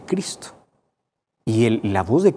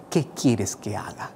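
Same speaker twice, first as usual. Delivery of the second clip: rendido suave; 4 semitones lower, 7 dB quieter than usual. Retold or imitated.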